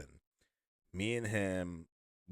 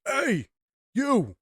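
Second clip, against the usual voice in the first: much higher, much louder mock holler; imitated gruff shout; 10 dB louder than usual. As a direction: call out like a huge stranger hailing someone. Huge stranger accosts them in line.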